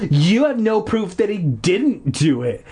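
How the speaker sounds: ordinary delivery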